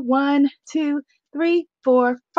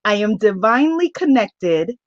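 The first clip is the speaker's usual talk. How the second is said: slow, low incantation; affirmation reading; 5 semitones lower than usual. Real, not acted. Host reads an affirmation card to her goddess sisters, slow solemn intoning. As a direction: intone it slowly, sinking lower toward the end.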